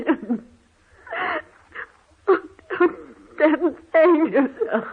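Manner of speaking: a shocked wife cracking into laughter; hysterical laughing. Acted. Wife breaks down at the news.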